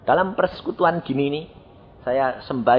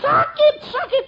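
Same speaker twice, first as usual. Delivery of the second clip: high pained cries; mock anguished cry. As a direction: wail from the burning light. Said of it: "dia berteriak".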